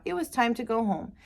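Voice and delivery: usual speaking voice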